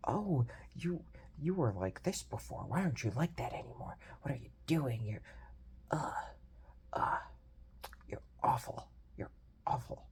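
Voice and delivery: whispered imitation of someone scolding; mock accusing whisper. Imitating people who blame him for changing, whispered. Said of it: "if you start going like".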